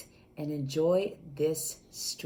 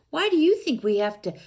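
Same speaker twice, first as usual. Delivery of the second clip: usual speaking voice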